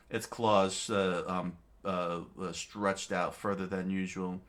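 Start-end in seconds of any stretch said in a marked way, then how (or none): none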